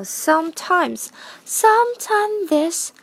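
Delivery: "sometimes" lifted high and drawn out; lilting upward start on "sometimes"; rising opening tune. Shown as a demonstration. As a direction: let "sometimes this" climb upward in pitch. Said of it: "是往上扬的这个调调".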